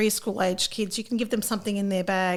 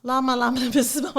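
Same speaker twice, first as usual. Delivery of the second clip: laughing; 3 semitones higher, faster than usual. Real, not acted. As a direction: repeat the title through a laugh, a little quicker. Repeating the funny book title.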